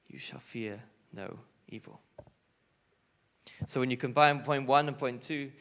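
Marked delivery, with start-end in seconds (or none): 0.1-2.0 soft, whispered "You shall fear no evil"; whispered reassurance from God, much softer; hushed whisper. Acted. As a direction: breathe the promise gently.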